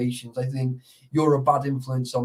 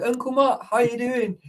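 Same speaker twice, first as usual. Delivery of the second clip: high, sing-song mock greeting; chirpy piss-take hello; cheeky teasing greeting; 9 semitones higher than usual. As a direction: wind up a mate with a nickname. Teasing friend by a nickname.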